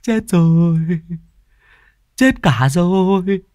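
high, eerie wail of a madman; crazed, cackling prophet of death. A narrator's reading. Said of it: "tiếng cười khằng khặc".

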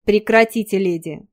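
loud angry snap; growled. Acted. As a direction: growl it to push her off. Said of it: "прорычал он".

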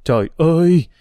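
a mild dismayed exclamation; cry out. A narrator's reading. Aunt learns Bích Vân's father is a murderer, mildly cries out. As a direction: exclaim in shock, lightly voiced. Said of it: "Bà kêu lên".

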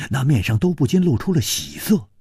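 plain reading voice

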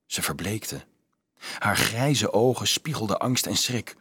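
usual speaking voice

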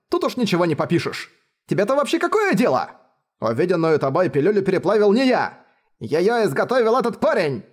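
high, furious ranting; berserk rage. A narrator's reading. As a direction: rage out of control. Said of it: "в ярости вскричал".

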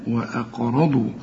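"وأقرضوا" with a bounced qaf; qalqala on qaf. Shown as a demonstration. Teacher demonstrating qaf bounce in "وأقرضوا". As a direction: let the qaf in "وأقرضوا" echo. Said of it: "قلقل بقى القاف".

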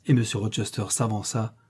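usual speaking voice

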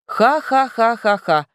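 slow, theatrical evil laugh; villainous laugh. Acted. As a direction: cackle like a mustache twirling villain. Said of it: "Это был злодейский смех".

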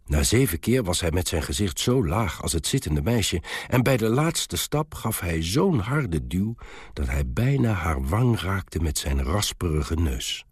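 ordinary delivery

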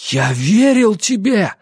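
accusing cry. A narrator's reading. Ansel accusing Lucy of betrayal, accusing cry. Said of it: "обличительно закричал он".

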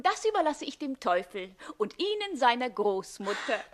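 usual speaking voice

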